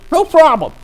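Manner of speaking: put-on high, eager teen holler; shouting student. Student inviting the lawyer's questions, high eager shouting. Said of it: "My ears ache already".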